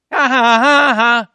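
loud, high "ha ha ha"; defiant laugh. Acted. Believer laughing at what can't touch him, defiant laugh. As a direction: laugh it out boldly after the challenge.

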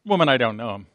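soft, low, offhand denial; understated and dropped in pitch; mild denial. Acted. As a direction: toss it off quietly, without force. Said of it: "It wasn't".

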